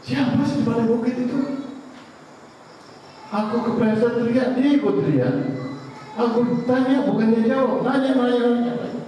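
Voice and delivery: put-on high-pitched, complaining child voice; agitated child. Confused boy complains to his father about the echo. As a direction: whine to dad like a confused kid. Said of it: "Nanya ke bapaknya".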